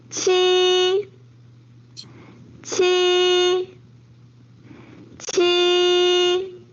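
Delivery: 七 held high and level; 七 Mandarin first tone, level. Shown as a demonstration. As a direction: hold 七 flat and high. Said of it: "七 is first tone".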